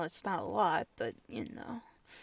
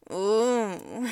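loud sudden moan, louder; 11 dB louder than usual. Acted. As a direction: let out a sharp pleasured moan. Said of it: "I love that moan you just did".

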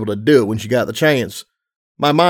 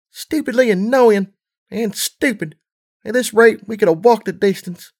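annoyed little filly; 9 semitones higher than usual. A narrator's reading.

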